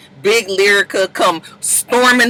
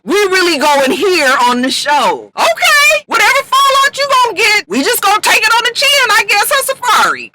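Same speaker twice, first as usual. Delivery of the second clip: excited mock outrage; 10 semitones higher than usual. Real, not acted. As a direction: throw up your hands at the show's drama. Host mocking the show.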